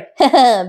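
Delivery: short chuckle; a little laugh to oneself; quick amused laugh. Real, not acted. Amused while mocking the character.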